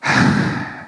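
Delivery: long tired sigh with no words; weary sigh demo. Shown as a demonstration. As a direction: let out a fed-up sigh. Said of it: "Tapi nadanya".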